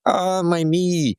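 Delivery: high, drawn-out cry of pain; mock hurt groan. Wounded soldier in dad's example, acted pain. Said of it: "he's hurt".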